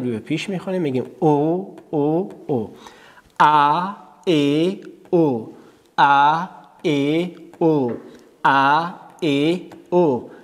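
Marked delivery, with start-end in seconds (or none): 1.2-2.7 "او" said as a rounded "o"; damma vowel. Shown as a demonstration. Teacher models damma for pupils, rounded drawn-out "o" on "او". 3.4-10.3 "ا، ا، او" as "a, e, o", slowly; three short vowels drilled. Shown as a demonstration. Teacher drills the pupils, slow separate "a, e, o" on "ا، ا، او".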